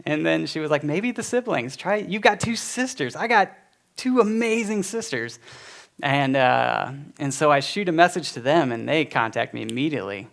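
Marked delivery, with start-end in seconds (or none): 0.8-5.4 a hint of the mother's voice, higher; lightly voiced mother. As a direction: voice her gently, pitched up. Quoting mother's suggestion.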